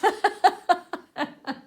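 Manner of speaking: bright burst of laughter; high loud laugh. Real, not acted. Laughing after cheeky prayer.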